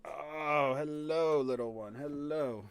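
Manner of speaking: soft, drawn-out cooing; real affectionate pet talk; tender pet greeting. Real, not acted. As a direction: coo a hello to a small pet. Greeting the pet Luna as she arrives.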